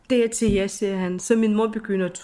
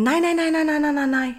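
high, insistent rapid no's; insisterende afvisning; 7 semitones higher than usual. Retold or imitated. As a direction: refuse eagerly, pitch up. Insisterer på ikke at tage penge. (ivrigt, højt afvisende nej)

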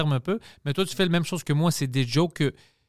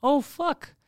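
higher-pitched mock surprise; retold realization; 11 semitones higher than usual. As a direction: act out a sudden realization, pitched up. Retold moment of sudden connection. (surprise rejouée, voix plus aiguë)

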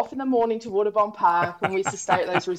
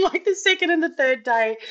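normal talking voice

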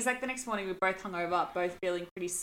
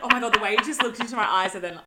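ordinary talk

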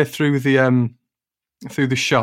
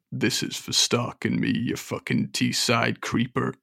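menacing [name]; 6 dB quieter than usual. Acted. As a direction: threaten quietly like a rocker. [name] strangling narrator.

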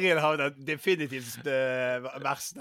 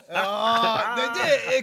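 ordinary talk, nothing marked

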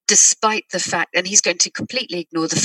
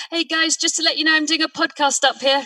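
polite call upstairs; 10 semitones higher than usual. Pretend. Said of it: "That was a polite way of doing it".

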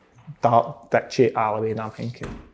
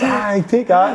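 high-pitched gloating jeer; imitated taunt; 8 semitones higher, much slower than usual. Friend gloating as police stand by, imitated jeering. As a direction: crow it in mock triumph.

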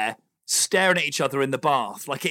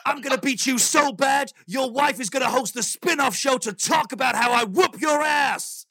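wrestling voice; 8 semitones higher than usual. Pretend. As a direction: yell it like a wrestler hyping a match. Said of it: "you gotta get a wrestling voice going on".

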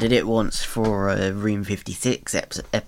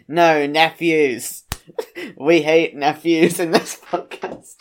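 slow, higher and louder, breaking into laughs; laughing mock decree; 6 semitones higher, 4 dB louder, slower than usual. Actor banning nephews from this podcast, laughing mock announcement. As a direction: proclaim it slowly through laughter.

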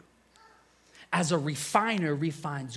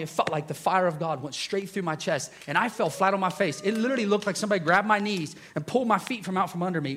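usual speaking voice

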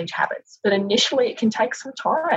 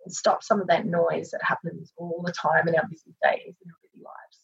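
soothing massage murmur. Acted. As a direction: ease them gently into breathing. Leads a guided breathing meditation.

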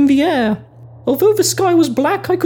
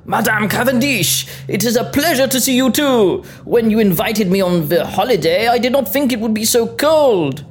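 put-on French accent; 6 semitones lower than usual. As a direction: lower French man's voice. Frenchman Jean Celine speaks.

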